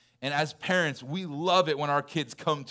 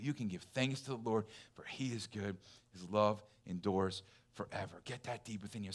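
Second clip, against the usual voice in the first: soft, intimate, much quieter refrain; hushed scripture refrain; 4 semitones lower, 10 dB quieter than usual. Real, not acted. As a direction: let the verse sink in, almost murmured. Softly repeating scripture refrain.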